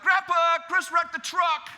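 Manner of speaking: high, loud, drawn-out yell; imitating girls yelling the news; tattling shout. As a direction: holler for Grandpa like a tattling kid.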